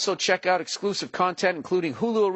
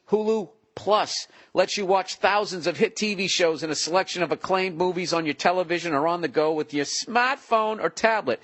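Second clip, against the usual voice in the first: normal talking voice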